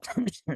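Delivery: a single rough chesty cough. Real, not acted. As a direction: cough hard mid-sentence. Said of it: "I'm coughing".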